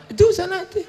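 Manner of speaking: squeaky high laughing point; high giggling answer; mock widow laughing; laughing high reply.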